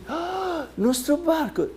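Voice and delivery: high-pitched horrified gasp and cry; shocked men. Acted. Men seeing their own ships burned, acted high shocked cry.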